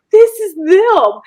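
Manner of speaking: high, slow starstruck gush; hammed-up fangirl awe, drawn out. Mom hiding behind her daughter on seeing idols, fangirling.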